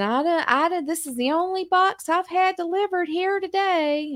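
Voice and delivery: put-on high old lady voice; spry old voice. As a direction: play a sweet old neighbour.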